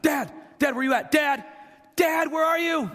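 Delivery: high, loud, frantic shouts for dad; panicked child calling. Retold or imitated. Son alone in his room shouting for dad, high and panicked. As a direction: yell like a scared kid who lost his father.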